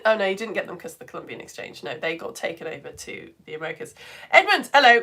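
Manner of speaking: ordinary talk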